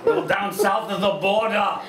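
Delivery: exaggerated action-hero accent; Arnold impression. Shown as a demonstration. Judge suggests an Arnold line to the comic, hammy impression. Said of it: "Arnold should say to her".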